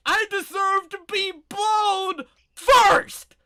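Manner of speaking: high, loud, slow screaming rant; furious shout. Retold or imitated. Husband ranting at his wife over the phone, loud furious shout. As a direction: bellow it like a tantrum.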